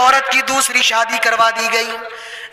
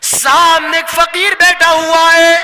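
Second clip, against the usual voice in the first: louder and higher, stressing بیٹھا; emphatic repeat; 4 semitones higher, 5 dB louder than usual. Acted. Preacher stressing the beggar at the door, louder. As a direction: repeat it with more force for the congregation. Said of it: "repeat of the line".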